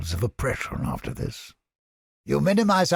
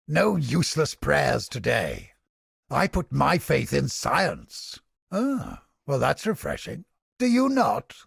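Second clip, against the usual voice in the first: usual speaking voice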